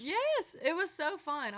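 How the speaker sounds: high, loud gushing agreement; real excitement. Real, not acted. Singer agreeing with host about tailgate country shows, thrilled and loud.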